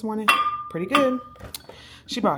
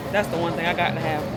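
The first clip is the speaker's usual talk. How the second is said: emphatic; 7 dB louder than usual. Real, not acted. Excited vlog, loud opener.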